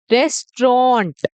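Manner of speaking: "रेस्टोरेंट" as slow res... trawnt; correct pronunciation. Shown as a demonstration. Teacher pointing to the written form, slow split res-trawnt for "रेस्टोरेंट". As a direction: say "रेस्टोरेंट" slowly, two split syllables. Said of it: "यहाँ पे मैंने लिखा भी है".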